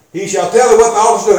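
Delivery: rapid recap of scripture, run together; quick re-reading. Shown as a demonstration. Preacher repeating a verse before citing it, quick re-reading. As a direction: rattle the verse off fast.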